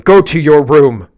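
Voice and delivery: loud, slow, bossy parent voice; mock stern parent order. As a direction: bark it like a parent sending a kid off. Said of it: "as if I told you to".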